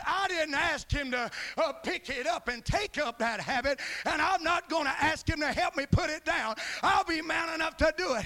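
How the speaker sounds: loud, proud declaration; preacher voicing the man's proud boast, loud and defiant; defiant vow. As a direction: shout it like a stubborn man. Quoting a proud smoker's vow.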